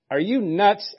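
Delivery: "Are you nuts?" pitched high and rising; incredulous. Retold or imitated. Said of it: "a more literal translation".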